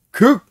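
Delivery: a sharp offended grunt, high and loud; a short, high grunt as the remark stings; stung grunt. Retold or imitated. Calligrapher stung by the director's words, sharp grunt.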